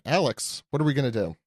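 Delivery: ordinary talk, nothing marked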